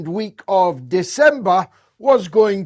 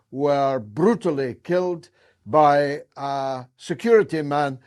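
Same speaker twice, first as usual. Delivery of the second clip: usual speaking voice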